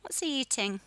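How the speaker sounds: normal talking voice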